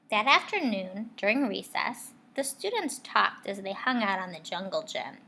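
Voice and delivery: plain reading voice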